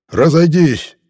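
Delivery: loud, high furious bark; angry bark. Acted. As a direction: bark it angrily at the boy. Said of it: "рявкнул со злобой".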